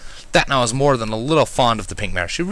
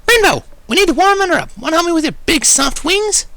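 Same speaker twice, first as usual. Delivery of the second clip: Pinkie asking; 6 dB louder than usual. A narrator's reading. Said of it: "the mare asked".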